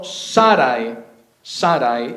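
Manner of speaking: "Sarai" as SA-rai, slow and first-syllable stressed; correct-way demo, "Sarai" stressed on SA. Shown as a demonstration. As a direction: say "Sarai" slowly, accent up front, gliding ai at the end. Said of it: "con acento en la segunda A".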